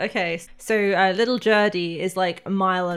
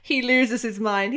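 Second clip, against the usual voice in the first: ordinary talk, nothing marked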